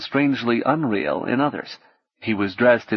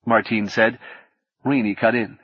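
ordinary delivery, nothing marked

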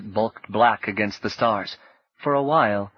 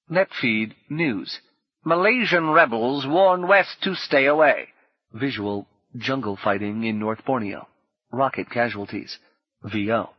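news read; 4 semitones higher than usual. A narrator's reading. Netfeed news bulletin about Malaysian rebels, anchor style.

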